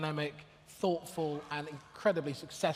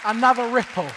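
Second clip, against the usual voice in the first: loud, raised call over a crowd; 4 semitones higher, 13 dB louder than usual. Real, not acted. Host asking the audience for more applause, calling out. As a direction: project it high to the whole room.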